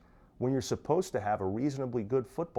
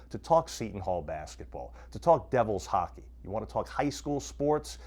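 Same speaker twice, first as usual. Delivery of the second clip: ordinary talk, nothing marked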